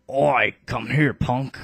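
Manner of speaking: a British bruiser calling someone over; redone British accent. Acted. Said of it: "Canius is gonna have the British accent".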